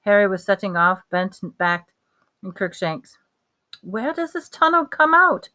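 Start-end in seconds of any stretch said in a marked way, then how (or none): 3.8-5.5 higher, urgent question; mildly breathless urgency. A narrator's reading. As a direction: ask it anxiously in a high voice. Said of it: "Hermione asked breathlessly".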